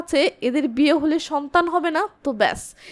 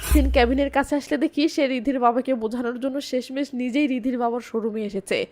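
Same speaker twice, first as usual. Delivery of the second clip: usual speaking voice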